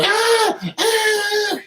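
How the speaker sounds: very high mock scream; woman screaming. Imitating the woman screaming in the back, high squeal. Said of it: "she's screaming".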